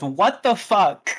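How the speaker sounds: loud, drawn-out demand; indignant outburst. Acted. Cecile answers the comm link, indignant outburst. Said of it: "It immediately opens with".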